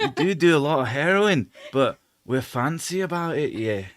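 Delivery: a hint of a refined Edinburgh Scots; lightly done Edinburgh Scottish accent. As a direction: put on a posh Edinburgh lilt. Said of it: "Edinburgh style accent".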